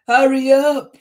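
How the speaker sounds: high, loud storybook shout; bus driver's shout. A narrator's reading. Bus driver rushing Tisha along, high urgent shout. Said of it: "shouted the bus driver".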